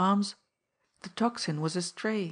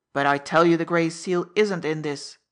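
put-on louder, snapping at the old man; impatient snap; 8 dB louder than usual. Laroque snapping at the frightened minister to stop worrying. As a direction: bite the words off impatiently. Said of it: "snapped Laroque impatiently".

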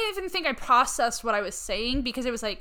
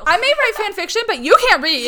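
laughing; 10 semitones higher, 9 dB louder than usual. Real, not acted. Mock insult, laughing.

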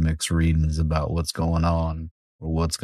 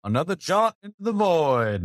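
toast; 12 semitones higher than usual. Acted. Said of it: "holds the glass in the air and says".

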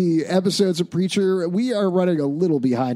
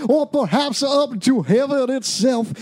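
hammed-up preacher, high and loud; booming mock sermon intro; monster truck ad; 10 semitones higher, 4 dB louder, slower than usual.

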